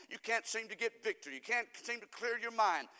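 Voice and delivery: normal talking voice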